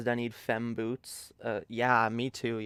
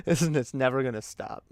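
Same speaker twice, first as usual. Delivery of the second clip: ordinary delivery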